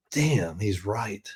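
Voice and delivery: low, slow and soft, to himself; rueful realization. Retold or imitated. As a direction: re-enact admitting it quietly to yourself. Rueful private realization while driving.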